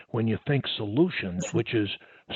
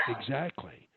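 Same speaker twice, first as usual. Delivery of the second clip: whispered; 2 semitones lower, 8 dB quieter than usual. Real, not acted.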